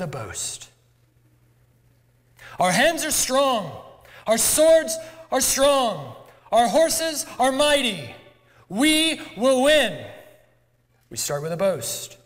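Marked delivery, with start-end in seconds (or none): 2.6-10.1 booming, slow, higher war speech; rallying battle cry. Acted. Commander to frightened soldiers, booming slow battle boast. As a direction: rouse the troops to charge.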